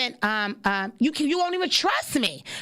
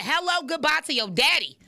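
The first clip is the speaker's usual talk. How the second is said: ordinary talk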